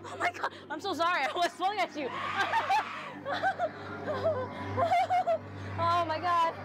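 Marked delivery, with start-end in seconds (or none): 2.1-5.7 overwhelmed surprise. Real, not acted. Overwhelmed in haunted maze.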